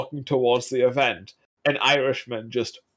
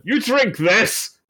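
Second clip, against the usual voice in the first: higher, drawn-out order; mock angry command; 7 semitones higher, much slower than usual. Angry Irishman ordering a newcomer to drink, mock-angry. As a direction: imitate an angry man pushing a drink, slowly. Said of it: "a random angry Irishman's going".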